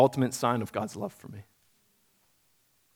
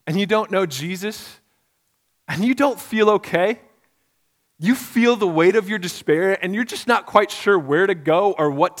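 higher, louder appeal; impassioned preaching; 5 semitones higher, 5 dB louder than usual. Acted. Pastor urging the congregation to turn to Jesus, impassioned. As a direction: urge them to turn to Jesus. Altar call to listeners.

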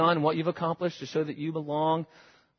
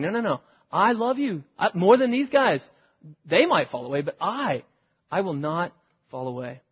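emphatic rebuke; 3 semitones higher, 3 dB louder than usual. Acted. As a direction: protest eagerly, voice climbing. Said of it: "emphatic rebuke of Jesus".

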